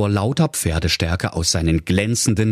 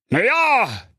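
king voice. Acted. King continues.

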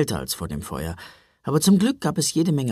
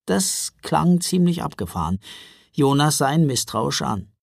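ordinary delivery

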